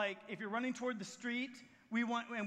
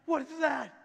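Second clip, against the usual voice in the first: loud startled question; a touch of acted alarm; 3 semitones higher, 8 dB louder than usual. Elijah hiding with pounding heart, mildly acted alarm.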